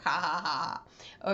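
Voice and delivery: spoken, deadpan ha-ha-ha; half-hearted mock laughter; fake laugh. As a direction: laugh dutifully at your own explained joke. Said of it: "It's a joke".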